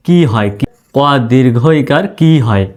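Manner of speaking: ordinary talk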